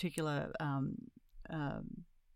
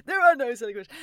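higher, louder mock-teacher voice; hammed-up classroom reassurance; true teacher; 7 semitones higher, 10 dB louder, faster than usual. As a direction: playfully proclaim it like a schoolteacher. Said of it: "spoken like a true teacher".

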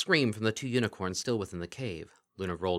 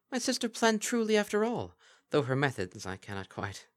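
higher, stately and formal; regal Luna voice; 7 semitones higher than usual. Acted. Luna to the mares, stately and regal. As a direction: speak as a proud ancient princess.

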